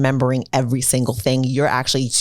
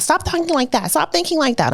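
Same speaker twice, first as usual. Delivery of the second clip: much higher, nagging scold; scolding parent; 13 semitones higher than usual. Retold or imitated. Mocking how adults scold teens, high nagging tone. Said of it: "I'm not".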